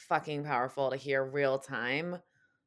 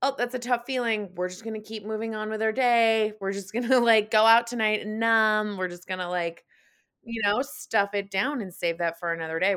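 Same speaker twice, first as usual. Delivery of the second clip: higher, louder, acted-out avoidance; mock brush-off; 6 semitones higher, 7 dB louder than usual. Voicing how easy avoiding tough emotions is, mock dismissive. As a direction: play someone breezily dodging a feeling. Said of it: "so easy to just be like".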